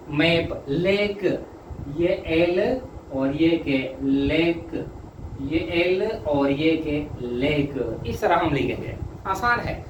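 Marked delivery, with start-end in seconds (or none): 2.0-4.0 slowly name each letter for the class; slow letter-by-letter spelling. Shown as a demonstration. Teacher spells out shorthand letters. 5.4-7.3 slowly name each letter for the class; slow letter-by-letter spelling. Shown as a demonstration. Teacher spells out shorthand letters.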